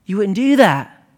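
normal talking voice

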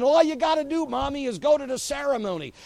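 high and loud, begging; unsaved kids begging their mother to give in; pleading child. Acted. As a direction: plead like a kid to mommy.

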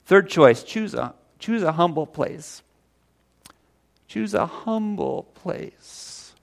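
fighting back real tears; real weepy catch in voice; tearful. Real, not acted. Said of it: "I don't know why I'm weepy today".

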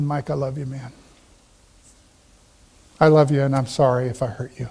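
soft, low, tender apology; sincere apology. Retold or imitated. Pastor apologizing to the friend he turned around to, softly sincere.

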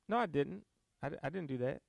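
soft, low denial of blame; mock denial. Acting out someone denying to God.

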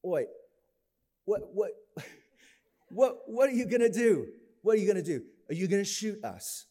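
lightly done British playground taunt; mock British kids. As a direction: jeer in a cheeky British accent. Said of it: "They're like".

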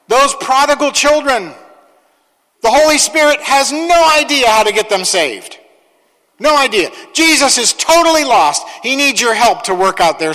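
high mock disbelief; sarcastic. Sarcasm about Holy Spirit.

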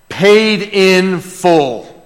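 drawn-out triumphant declaration; very slow preaching. Acted. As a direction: stretch "Paid in full" out very slowly. Preacher savours the phrase.

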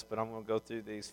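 ordinary talk, nothing marked

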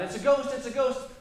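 high, loud imitated panic; mock panicked cry. Preacher retelling the disciples' fright, mock panicked cry. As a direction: replay the disciples' panic, hammed-up. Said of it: "the disciples cry out".

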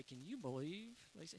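low, hushed aside to a spouse; hushed car gossip. Retold or imitated. To spouse in the car, a hushed gossipy aside. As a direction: mutter it like gossip out of earshot. Retelling a whispered complaint to spouse.

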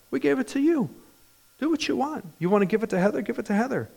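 high-pitched, as if telling mom it's obvious; voiced up high, imitating his own reply to mom; retold reassurance. Son reassuring mom in retold call.

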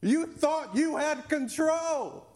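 mocking God.

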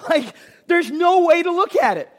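ordinary talk, nothing marked

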